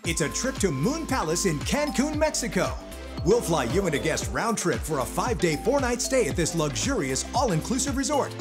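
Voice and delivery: normal talking voice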